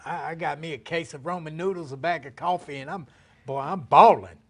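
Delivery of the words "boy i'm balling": much louder joking brag; mock boast. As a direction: crow about it like you're rich. Bragging about noodles and coffee.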